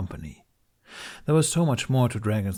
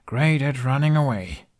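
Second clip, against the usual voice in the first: louder, sarcastic jab at himself; bitter self-mockery; 6 semitones higher, 5 dB louder than usual. A narrator's reading. Hiccup mocking himself to the dragon, bitter and sarcastic. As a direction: sneer at your own cowardice. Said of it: "Hiccup snorted".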